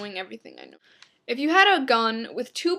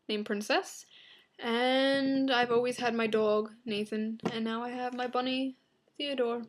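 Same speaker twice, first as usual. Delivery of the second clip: usual speaking voice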